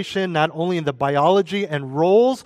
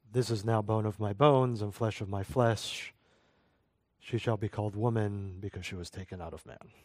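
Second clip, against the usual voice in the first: lower, softer, hushed solemn Adam; mock solemn Adam; 5 semitones lower, 9 dB quieter than usual. Preacher jokingly voicing Adam, over-solemn. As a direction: recite it like a grave ancient oracle. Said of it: "I'm joking. I doubt he said it like that".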